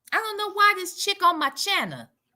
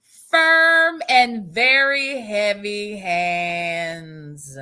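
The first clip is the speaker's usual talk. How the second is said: very slow, low, weighty delivery; drawn-out warning; 8 semitones lower, much slower than usual. Acted. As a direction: stretch each word like a threat. Reads comeback with drawn-out menace.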